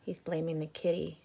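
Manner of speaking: usual speaking voice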